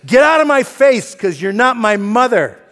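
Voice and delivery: loud, high outburst in imitation; angry yell. Husband retelling his fight with wife, angry yell. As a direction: act out a furious husband's shout.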